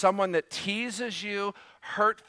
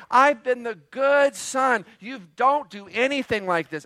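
put-on higher and louder, a wounded older son protesting; self-pitying complaint; 6 semitones higher, 3 dB louder than usual. Elder brother complains to his father, self-pitying and raised. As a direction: whine that you've been the good son all along. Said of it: "Again, self-pity".

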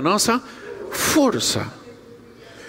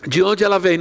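usual speaking voice

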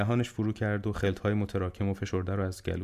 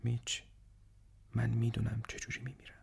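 hushed, voiceless whisper; 3 semitones higher, 9 dB quieter than usual. A narrator's reading. Dying man confiding in his visitor how he will die, whispered. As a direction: drop right down to a soft whisper. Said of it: "با صدای زمزمه وار گفت".

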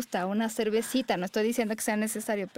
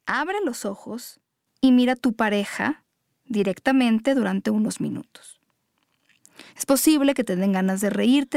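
louder, firmer meditation read; guided meditation; 7 dB louder than usual. Acted. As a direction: lead the couple through each step.

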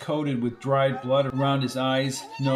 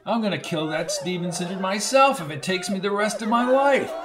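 high furious vow, building to the end; savage fury; 6 semitones higher than usual. A narrator's reading. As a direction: swear revenge, rising as you go. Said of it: "he cried in a savage fury".

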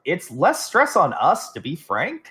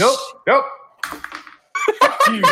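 mock angry; 6 dB louder than usual. Angrily leaving chair.

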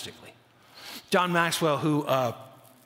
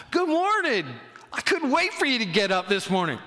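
hammed-up eager morning greeting, starting high; showing enthusiasm; 4 semitones higher than usual. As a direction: greet them with mock over-the-top enthusiasm, easing off after the first words. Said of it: "Just showing a little enthusiasm".

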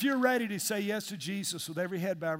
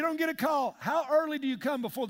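raised exhortation; 6 semitones higher than usual. Real, not acted. Pastor urging volunteers to come early, raised preaching.

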